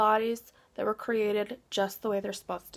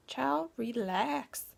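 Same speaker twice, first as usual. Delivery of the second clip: drawn-out urging; much slower than usual. Real, not acted. Coaxing viewers before meditation, drawn-out "child, relax".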